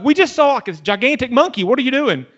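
mock-panicked, high-pitched protest; high incredulous outburst, imitated; freaking out. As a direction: act out a freaked-out companion. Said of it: "like, freaking out".

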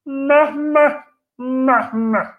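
high-pitched bleated nonsense syllables; squeaky, repeated "meh" noise; silly noise demo. Shown as a demonstration. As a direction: show off an odd high bleat. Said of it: "how come I can do this".

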